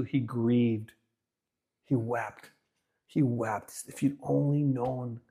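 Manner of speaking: soft, grieving hush; hushed grief. Acted. Preacher telling how Jesus wept over sinners, hushed grief. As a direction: let the words sink to a mournful hush.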